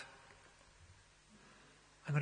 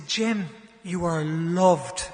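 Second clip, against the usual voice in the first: much louder, dragged out slowly; slow loud proclamation; 3 semitones higher, 13 dB louder, much slower than usual. Retold or imitated. Jim leading the final affirmation, slow and loud. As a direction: stretch every word to the back of the hall. Drawn-out climactic line.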